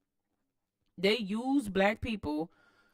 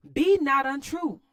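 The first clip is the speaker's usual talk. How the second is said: impassioned plea; 6 semitones higher, 9 dB louder, slower than usual. Acted. Reading Du Bois' plea to Wilson.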